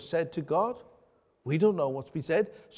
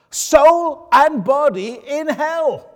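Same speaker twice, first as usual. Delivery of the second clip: slow, louder and higher on hell; emphatic scripture climax; 10 semitones higher, 11 dB louder, much slower than usual. Real, not acted. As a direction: drive the warning home.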